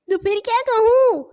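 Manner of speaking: high, innocent question; high puzzled question of a simple boy. Acted. Hasan asks, bewildered.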